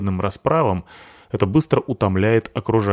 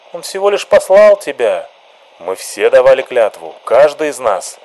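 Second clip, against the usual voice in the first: luid, hoog en opgezweept; fiery activist; 4 semitones higher, 5 dB louder than usual. Acted. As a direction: hits de menigte op.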